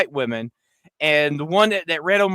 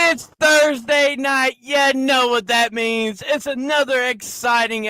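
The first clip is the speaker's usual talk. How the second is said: hype intro; 7 semitones higher than usual. Acted. Podcast host opening.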